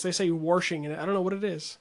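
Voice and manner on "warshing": washing said as warshing, with an R; dialect warshing. Shown as a demonstration. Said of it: "it's like certain dialects".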